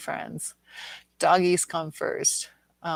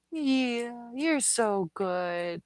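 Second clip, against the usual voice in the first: tender pet praise; 6 semitones higher, much slower than usual. Real, not acted. Owner cooing slowly to her sleepy old dog, tender. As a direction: linger on each word like soothing the dog.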